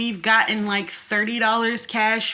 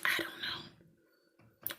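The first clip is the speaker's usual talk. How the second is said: slow, breath-only whisper; whispered aside; 16 dB quieter, much slower than usual. Real, not acted. Wondering about her own brain, whispered aside. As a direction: whisper it slowly to yourself.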